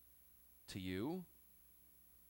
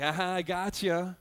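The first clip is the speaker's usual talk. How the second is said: gloating; 4 dB louder than usual. Retold or imitated. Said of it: "the sense of, like".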